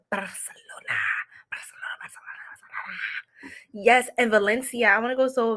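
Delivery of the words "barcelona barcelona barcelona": breathy dreamy whisper, much softer. Real, not acted. Longing for Barcelona to the chat, a whisper. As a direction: whisper it like a secret wish.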